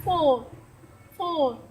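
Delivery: "Full" said as foo, the oo stretched; vowel demo word. Shown as a demonstration. Child teacher models the oo word for viewers. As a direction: model "full" slowly for learners. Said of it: "U, U, U".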